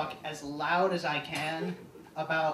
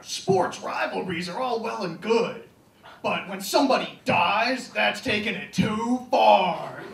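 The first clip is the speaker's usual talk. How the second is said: slow, louder, higher mock anchor outrage; indignant newscaster; 5 semitones higher, 8 dB louder than usual. Newscaster lecturing viewers about a fatal bar fight, indignant. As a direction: sermonize like a self-righteous anchor. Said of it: "the newscaster just gets really indignant".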